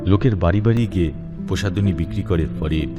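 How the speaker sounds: ordinary talk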